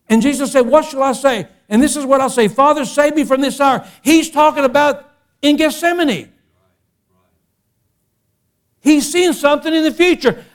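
high, impassioned pulpit voice; sermon climbing to full cry; full-force preaching. Acted. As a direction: preach it with rising intensity, pitched high.

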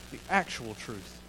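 "actual" stressed, dropped to a near hush; hushed repeat. Shown as a demonstration.

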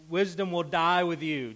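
sarcastic Wisdom hit high and loud. Retold or imitated. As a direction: lean on Wisdom with open sarcasm. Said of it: "he's being sarcastic about it".

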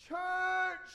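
put-on loud, high shout like a three-year-old; imitated excited child. Three-year-old son asking dad about church, shouting. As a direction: blurt it out at full volume. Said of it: "He's three years old".

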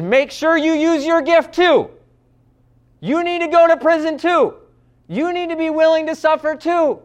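rising to a high, loud exhortation; Paul's urgent charge. Retold or imitated. Paul urging Timothy from prison, high and loud. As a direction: push it like an apostle rallying his protégé. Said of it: "Think of what Paul is saying here".

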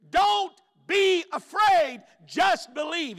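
very high, loud, drawn-out pulpit cry; God's command at full force; preacher's shout. Acted. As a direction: shout each word slowly to the congregation. Preacher voices God at climax.

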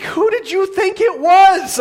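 high, loud, drawn-out incredulous question; hammed-up disbelief, pitch way up; mock exasperation. Husband to the wife he just startled, mock exasperation.

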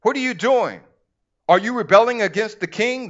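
high, loud scornful challenge; scoffing contempt. A narrator's reading. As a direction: question them with scoffing disbelief. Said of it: "they scoffed contemptuously".

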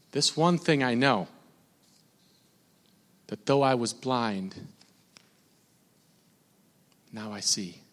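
soft, low, with weighty pauses; hushed scripture quoting. Real, not acted. Preacher quoting the healed blind man, hushed and solemn. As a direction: quote it quietly and solemnly.

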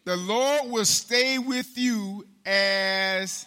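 drawn out, each word spaced apart; very slow. Shown as a demonstration. Preacher drags out the verse for the congregation. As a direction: stretch the verse out slowly. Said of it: "let's say it again".

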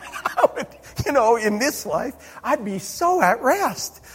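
laughing through the words, pitch high; giggling at his own bear fear. Real, not acted. Preacher laughs at his fear of bears, laughing.

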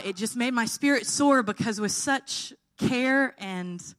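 usual speaking voice

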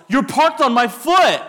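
high, loud, drawn-out yell. Retold or imitated. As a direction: yell it like your foot is trapped. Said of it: "he yells".

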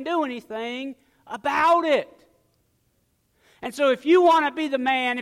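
insistent, full-voiced exhortation; forceful preaching. Real, not acted. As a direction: drive each point home to the congregation.

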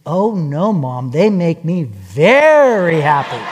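low, slow mock-earnest child with a long "very"; contradicting little boy. Boy answering his mom about his toys, emphatic contradiction. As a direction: correct Mom, dragging out "very". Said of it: "And he says".

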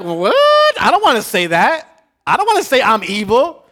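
high, loud indignant protest; shocked protest. Retold or imitated. Pastor voicing a congregant who refuses, with loud shocked protest. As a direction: recoil in disbelief at the idea. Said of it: "you're like".

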